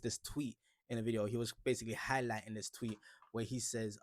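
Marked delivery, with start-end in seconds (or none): none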